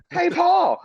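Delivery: very high, loud Paul; high movie quote. Retold or imitated. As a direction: squeal out the movie line. Quoting American Psycho scene.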